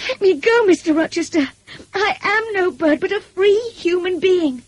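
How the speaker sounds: very high, impassioned defiance; indignant protest. A narrator's reading. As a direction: cry out to be released. Jane struggles free of his arms.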